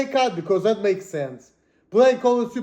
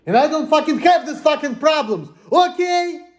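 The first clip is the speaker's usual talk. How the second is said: angry shout; 8 semitones higher than usual. Real, not acted. Vlogger yelling at people who doubt him, angry shout. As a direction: blow up at the accusers. Furious denial in vlog rant.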